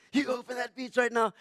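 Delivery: high, loud mock yell of an angry man; imitated screaming demand. Enraged man bursting in on the lifeguard, imitated shouted demand. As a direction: bellow the order as the raging stranger. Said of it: "he's screaming through the window".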